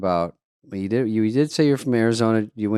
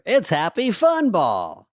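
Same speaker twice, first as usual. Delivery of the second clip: high, slow ad announcer; voiceover; 7 semitones higher, much slower than usual. Retold or imitated. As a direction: savor it like a TV spot. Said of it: "called in for the voiceover".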